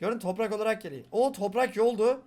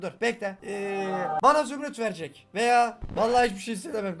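normal talking voice

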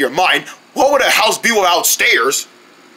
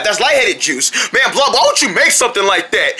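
ordinary talk

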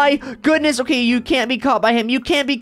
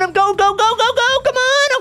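frantic urging; 10 semitones higher than usual. Real, not acted. Kid fleeing the puppet to dad, frantic urging. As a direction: pitch climbing higher with each go.

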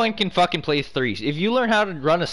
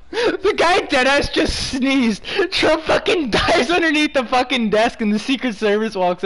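excited; 8 semitones higher, 3 dB louder than usual. Real, not acted. Retelling the clip, amused.

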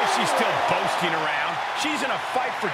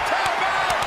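excited cry; 12 semitones higher than usual. Real, not acted. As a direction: yell it up at the peak. Commentator urges submission.

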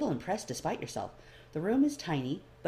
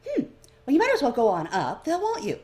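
lightly voiced man; 7 semitones higher, 7 dB louder than usual. The man answers the elf.

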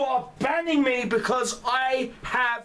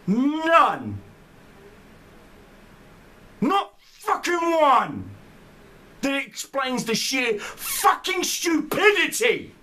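higher and louder, seething outrage; angry yell; 6 semitones higher, 4 dB louder, slower than usual. Real, not acted. Berating the game devs over his ban, real angry yelling. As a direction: spit each word in contempt. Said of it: "You're damn right I'm fucking angry".

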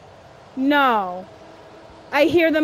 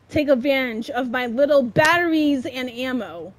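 normal talking voice

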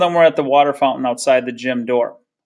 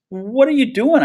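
shocked question; 11 semitones higher than usual. A narrator's reading. Brother outraged at sister.

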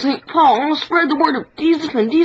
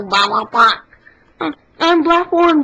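normal talking voice

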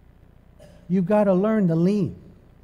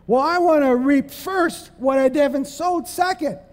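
mocking selfish voice; 9 semitones higher than usual. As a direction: stretch it out like a spoiled child. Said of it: "the Bible says you reap second".

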